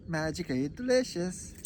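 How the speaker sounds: Irish leprechaun from a cereal ad; Irish accent. Acted. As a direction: do Lucky Charms in an Irish lilt. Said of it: "Lucky Charms".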